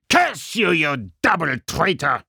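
put-on high, German-accented angry outburst; enraged German villain; furious cry. A narrator's reading. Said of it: "cried the German".

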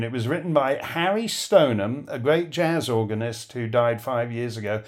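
normal talking voice

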